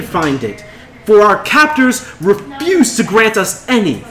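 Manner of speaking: high, loud rabble-rousing speech; rousing yell. Acted. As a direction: yell out to a crowd, rallying them. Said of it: "she yells".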